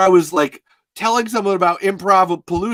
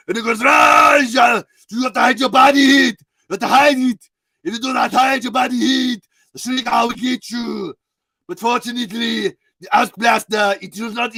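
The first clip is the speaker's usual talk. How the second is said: Arnold; 3 semitones higher than usual. Acted. Action hero warning schoolchildren about monsters, hammed-up Austrian accent with shouts. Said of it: "Arnold would call it grab it".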